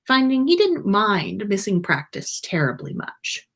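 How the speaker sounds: usual speaking voice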